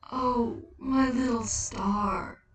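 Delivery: slow, choked-up tenderness; a moved figure embracing a child; slow tearful endearment; tender Death. Acted.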